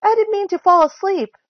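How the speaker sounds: high, loud apology; alarmed high-pitched excuse; flustered cry. A narrator's reading. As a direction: blurt it out, caught in the act. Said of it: "she cried".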